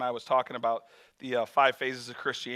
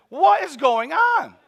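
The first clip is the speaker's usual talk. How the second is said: bewildered; 11 semitones higher, 7 dB louder, slower than usual. Retold or imitated. Bewildered thought during shouting scene.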